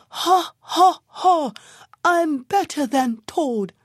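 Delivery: barely enough wind, push it out; worn-out donkey still bragging; breathless bawl. A narrator's reading. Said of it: "very tired and out of breath".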